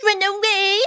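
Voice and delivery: high, slow mimicking retort; indignant washerwoman. A narrator's reading. Mole echoing the sergeant's order, indignant. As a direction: throw the order back in high mock outrage. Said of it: "says I".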